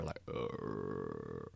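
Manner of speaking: very low buzzing hum. Shown as a demonstration. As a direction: drop down into a deep hum. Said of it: "obviously that's me doing it".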